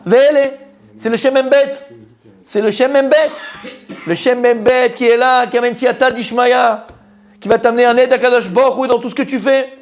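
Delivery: high, ringing preacher's voice at full force; fervent preaching. Acted. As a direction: preach it out to the room, lifted high. Rising preacher's fervour.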